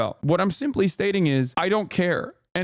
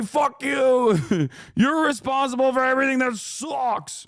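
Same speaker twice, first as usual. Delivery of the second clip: higher, hammed-up shouting of a whiny basement loser; mock angry gamer; 5 semitones higher than usual. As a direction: rant like a petty neckbeard yelling at a developer. Mocking an angry basement-dweller gamer.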